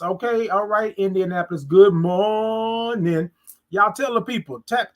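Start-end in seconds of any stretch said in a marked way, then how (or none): 1.6-3.3 drawn-out morning greeting. Acted. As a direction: stretch every sound like a morning show host. Greeting viewer in stream.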